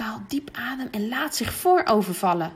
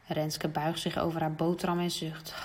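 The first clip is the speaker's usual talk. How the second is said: plain reading voice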